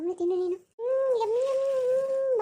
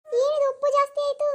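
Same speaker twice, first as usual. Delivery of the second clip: startled outcry; 6 semitones higher, 4 dB louder than usual. Acted. Stepmother shocked at the food.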